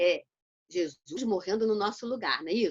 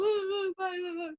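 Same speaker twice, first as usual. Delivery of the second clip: put-on child whimpering sobs; 9 semitones higher, 4 dB quieter than usual. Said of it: "o garoto veio chorando com a vara".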